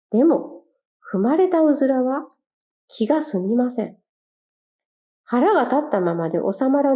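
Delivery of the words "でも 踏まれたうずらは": ordinary delivery, nothing marked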